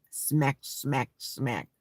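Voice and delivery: soft spoken smack smack of a happy eater; playful eating noises, trailing off low; lip smacking. Pretend.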